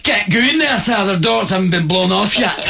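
Scottish stormtrooper. Retold or imitated. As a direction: yell it like a flustered Scottish trooper. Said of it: "one Scottish stormtrooper".

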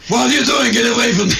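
put-on old magazine-shop man yelling at kids; grumpy old shopkeeper. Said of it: "like the old guy in the magazine shop".

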